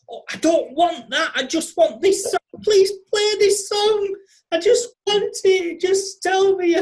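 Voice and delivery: high, loud mock tantrum at a voice assistant; exasperated pleading. Frustrated user begging Alexa to play a song, exasperated. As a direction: plead in a hammed-up, high-pitched frenzy.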